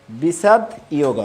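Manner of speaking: বিষাদ ইয়োগ, the যোগ said as ইয়োগ with a glide; বিষাদ ইয়োগ with a clear ইয় sound before ওগ; teacher's model pronunciation. Shown as a demonstration. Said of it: "এভাবে আমরা উচ্চারণ করব বিষাদ ইয়োগ".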